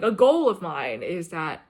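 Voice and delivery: usual speaking voice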